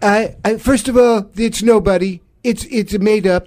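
blustering presidential impression; Donald Trump. President denying there is a leaker, blustering impression. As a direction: mimic a defensive, emphatic president. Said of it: "Who do you think it is, Don?".

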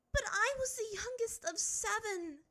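high, trembling voice; quivering. A narrator's reading. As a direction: hold back shaking emotion. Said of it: "she quivered".

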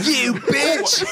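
high shouted Cockney insult; rough Cockney bear. Pretend. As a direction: spit it like a Cockney yob. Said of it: "What if Paddington sounded like that".

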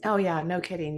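ordinary talk

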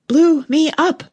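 high, loud breathless-queen outburst; panted exclamation. A narrator's reading. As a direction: exclaim it loudly as a winded queen. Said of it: "panted the queen".

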